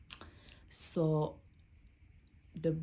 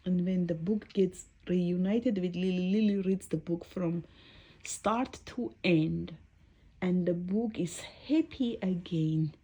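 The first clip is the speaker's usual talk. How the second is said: ordinary delivery, nothing marked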